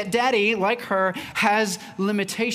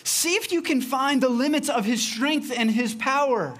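bold challenge; 6 semitones higher than usual. Acted. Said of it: "to push the limits and say".

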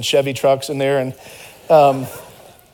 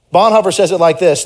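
normal talking voice

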